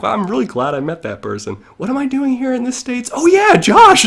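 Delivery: quick musing that jumps very high and loud on Josh; talking to himself, then remembering his friend with a high shout; sudden remembering. Acted.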